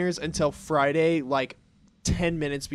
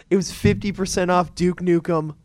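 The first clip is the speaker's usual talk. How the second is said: normal talking voice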